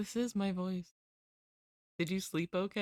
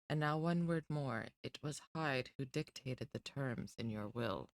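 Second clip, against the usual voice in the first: softer, lightly voiced reading of the book; story narration; 3 semitones lower, 4 dB quieter than usual. A narrator's reading. Streamer reading Jekyll and Hyde to chat, soft narration. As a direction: read the novel quietly to the listeners.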